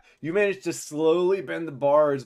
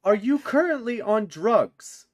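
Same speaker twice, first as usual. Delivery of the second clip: incredulous prisoner; 11 semitones higher, 5 dB louder than usual. Acted. Prisoner questions a foolish captive, high and incredulous.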